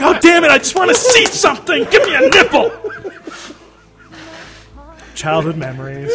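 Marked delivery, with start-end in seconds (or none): 0.0-2.7 high, loud exasperated whine; frustrated yell.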